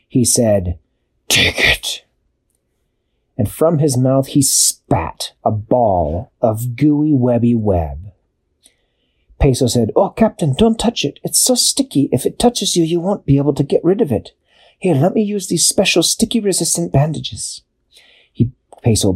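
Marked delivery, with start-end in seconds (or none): 1.3-2.1 breathy defeated spider; whispered spider. A narrator's reading. Spider gives up. 10.0-17.6 much higher, eager penguin warning. A narrator's reading. Peso warns captain.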